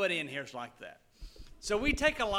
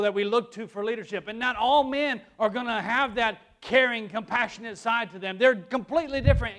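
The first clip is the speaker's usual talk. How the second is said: loud, raised sermon delivery; forceful preaching; 4 semitones higher, 7 dB louder than usual. Acted. Preacher telling church God made men different, forceful preaching. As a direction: drive each phrase home to the congregation.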